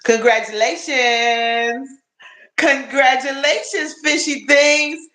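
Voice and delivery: high, drawn-out cheer; excited congratulations. Real, not acted. Streamer congratulates a buddy on getting monetized, high excited cheer. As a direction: stretch it out high, delighted for a friend.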